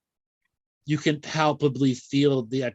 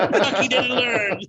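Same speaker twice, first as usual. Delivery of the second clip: laughing; 10 semitones higher than usual. Retold or imitated. Guides disappointed, laughing.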